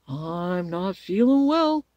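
slow, loud scarecrow drawl; smiling scarecrow. A narrator's reading. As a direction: draw it out with a smile. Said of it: "said the scarecrow with a smile".

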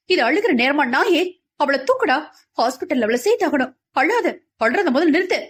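furious order to stop crying; fierce scolding. A narrator's reading. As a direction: snap him out of his tears like a lioness. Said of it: "பெண் சிங்கமாய் மகி கிளர்ந்தெழுந்த கூற".

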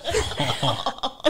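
laughing burst; real laugh. Real, not acted. Laughing after telling how she answered the proposal. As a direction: let a laugh break out.

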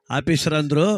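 ordinary talk, nothing marked

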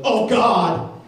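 loud, high cry of fright in the shaking plane; panicked cry. Retold or imitated. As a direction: yell it out, terrified by turbulence. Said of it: "the atheist goes".

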